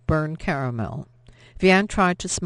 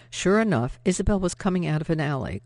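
plain reading voice

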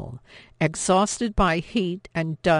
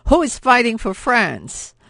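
angry shout; 6 semitones higher, 8 dB louder than usual. Acted. Gaetan attacks a soldier.

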